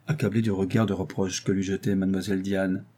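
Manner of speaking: usual speaking voice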